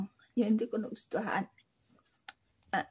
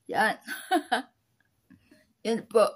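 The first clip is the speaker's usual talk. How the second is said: laughing. Real, not acted. Laughing at her own joke.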